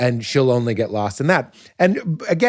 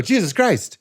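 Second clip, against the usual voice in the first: higher exasperated Jesus Christ; exasperated exclamation; 8 semitones higher than usual. Retold or imitated. Comedian acting out his exasperated punchline, a bit higher. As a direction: throw it out in mock exasperation. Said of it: "And I go".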